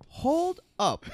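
high-pitched, incredulous hold-up; stunned disbelief. Retold or imitated. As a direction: stop them in sheer disbelief. Acted stunned reaction to remark.

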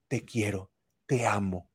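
a soft loving affirmation to a partner; tender, loving. Shown as a demonstration.

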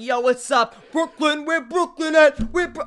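high, loud call into the crowd; a kid strutting in, calling for Brooklyn; swaggering hype call. Retold or imitated. Kid calls out for his crush, swaggering and high. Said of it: "I'm like".

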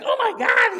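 very high, loud mock freak-out; imitated alarm. Wife watching him freak out, imitated alarm.

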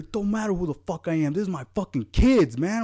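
high, heated confrontation, acted out; raging dad. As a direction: square up like a furious father. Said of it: "raging, bro".

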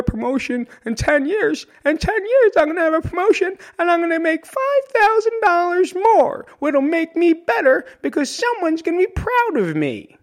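high, mocking voice of the ambitious worker; mock career climber. Speaker mocking a promotion-chaser's dreams, high and mocking. Mocking conventional life goals.

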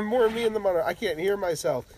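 high mock whine; whiny musician voice. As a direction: whine like a fussy player. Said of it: "What's the thing you hear the most?".

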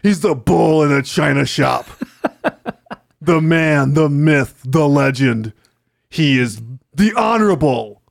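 slow, loud trailer narrator; movie trailer voice. Pretend. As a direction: announce him like an epic trailer. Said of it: "can you stop saying it like that".

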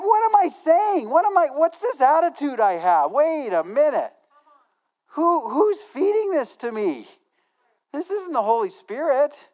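preacher replaying catching his own bad attitude; mock self-alarm. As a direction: catch yourself mid-sentence, alarmed. Acts his own inner alarm.

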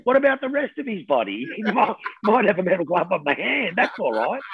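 high, disbelieving mock voice; incredulous mock. High mocking disbelief at the whiteboard story. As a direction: play the baffled sceptic reading the board. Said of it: "and I'd go".

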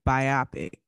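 biopic as bye-OP-ic; old pronunciation. Shown as a demonstration. Co-host defining a scripted film, old pronunciation bye-OP-ic. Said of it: "hearing people say biopic".

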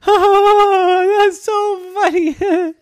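very high, loud, laughing squeal; laughing hard. A narrator's reading. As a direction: act helpless with laughter. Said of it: "she started laughing".

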